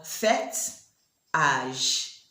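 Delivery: fête and âge said the French way; French words. Shown as a demonstration. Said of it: "Then we have".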